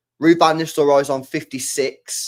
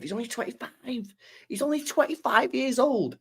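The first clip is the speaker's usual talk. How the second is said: amazed; 11 semitones higher, 4 dB quieter than usual. Real, not acted. Amazed at Haaland's record.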